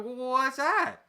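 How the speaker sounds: retold surprise. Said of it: "And I'm like".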